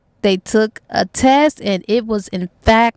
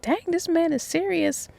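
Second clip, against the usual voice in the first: hushed, high-pitched amazement; disbelief; 6 semitones higher, 8 dB quieter than usual. Retold or imitated. Woman amazed he left his wife, hushed disbelief. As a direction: marvel under your breath, pitched up. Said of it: "she couldn't believe that".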